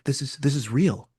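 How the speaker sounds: quietly imitating someone caught off guard; said softly. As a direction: drop the voice, lightly imitating a shaken friend. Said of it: "he says really softly".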